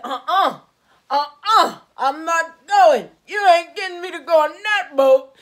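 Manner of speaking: loud, high, emphatic refusal; acted-out shouted protest. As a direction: dig your heels in and yell it. Acted refusal to board boat.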